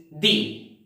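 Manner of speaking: "the" said like "dee"; "the" as thee. Shown as a demonstration. Teacher restates the vowel rule, "the" as thee. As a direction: give "the" an ee vowel, as in see. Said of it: "then this word is pronounced".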